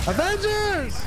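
high drawn-out battle cry. Acted. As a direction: call the team in like a war cry. Rallying cry again.

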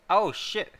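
a sudden, high, loud "oh shit" of surprise; surprised outburst. Real, not acted. Checking his quiz score, surprised outburst. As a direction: blurt it out, caught off guard.

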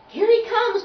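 high, loud excited call; ringing storybook shout of announcement. A narrator's reading. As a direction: call out the news across the orchestra. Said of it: "called the French horn".

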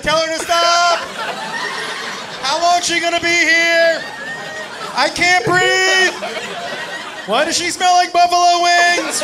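high, loud plea for rescue; mock desperate yelling. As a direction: yell it like someone pinned and helpless. Buddy pinned under her, pleading.

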